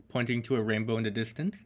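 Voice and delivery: ordinary delivery, nothing marked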